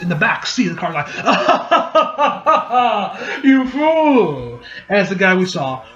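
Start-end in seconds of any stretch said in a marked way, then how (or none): 1.1-3.4 high, drawn-out villain cackle; over-the-top mock evil laugh; maniacal laugh. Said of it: "starts laughing maniacally". 3.4-4.6 higher-pitched mock villain cry; hammed-up maniacal taunt; gloating maniac. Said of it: "some guy starts laughing maniacally".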